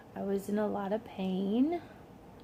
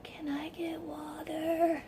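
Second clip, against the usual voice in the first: slow, strained whispered plea; sore-throat whisper; 7 semitones higher, 8 dB quieter, much slower than usual. Retold or imitated. Patient asking nurse for water after tube, croaky whisper. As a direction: imitate asking through a painful throat. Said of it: "My throat is killing me".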